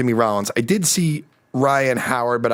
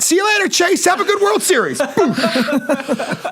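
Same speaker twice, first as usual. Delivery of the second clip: cocky laughing goodbye. Retold or imitated. Cocky parting line to player.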